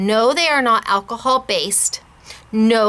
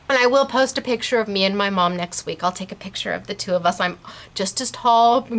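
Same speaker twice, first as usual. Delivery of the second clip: ordinary delivery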